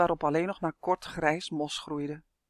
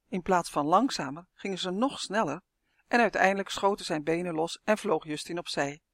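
usual speaking voice

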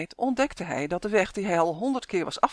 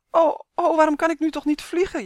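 high, loud lament; pitched-up, whining complaint; wailing; 10 semitones higher, 6 dB louder than usual. A narrator's reading. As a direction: wail in self-pity. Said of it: "jammerde Uf".

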